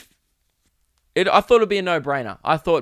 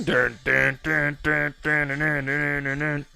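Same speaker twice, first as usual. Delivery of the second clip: hummed riff; slower than usual. Shown as a demonstration. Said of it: "That's what it would sound like if I tried to sing".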